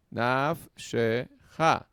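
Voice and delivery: "Naf shecha" said slowly, with "naf" leaned on; slow syllable demo. Shown as a demonstration. Teacher showing the class a closed syllable, slowly. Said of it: "Aquí está la cerrada".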